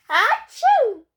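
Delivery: loud, high pretend sneeze; acted sneeze. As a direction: blast out a theatrical "achoo". Character sneezes in the story.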